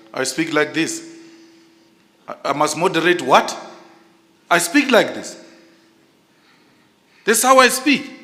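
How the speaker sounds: builds from firm to a high, loud shout; rising to a shout. Real, not acted. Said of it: "you are shouting now, not speaking".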